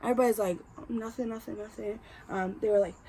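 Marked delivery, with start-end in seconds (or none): 0.9-2.0 meek denial. Retold or imitated. Classmates backing off meekly.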